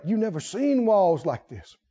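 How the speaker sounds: ordinary talk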